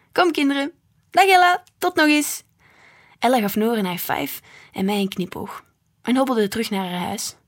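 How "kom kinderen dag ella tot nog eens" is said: higher and louder, calling across the street; calling goodbye. A narrator's reading. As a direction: call out a cheery farewell. Mama calls goodbye to Ella. (luid nageroepen afscheid)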